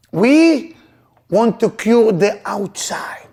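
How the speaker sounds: 높고 크게, 천천히 힘주어 설교; preaching at full force. Acted. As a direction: lift it and hammer each point home slowly. Preaching, Moses quote.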